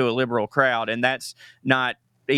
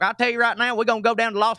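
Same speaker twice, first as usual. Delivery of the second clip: put-on dumb Southern accent; 9 semitones higher, faster than usual. Comedian mocking a TV actor playing dumb, Southern accent. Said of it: "his character was stupid. And so he was like".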